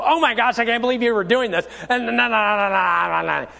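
mock scandalized scolding; preachy, judgmental. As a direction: rattle off a hammed-up sanctimonious rebuke. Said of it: "get all preachy and judgmental and go".

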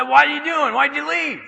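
loud, high-pitched confrontation; incredulous demand. Retold or imitated. Buddy confronting friend who left, incredulous demand.

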